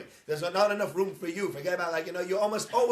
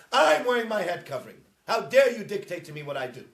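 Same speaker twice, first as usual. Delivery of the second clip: mock-indignant, louder and higher; indignant protest; 4 semitones higher, 9 dB louder than usual. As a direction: play the offended worshipper defiantly. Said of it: "they say".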